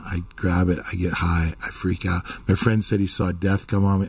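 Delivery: usual speaking voice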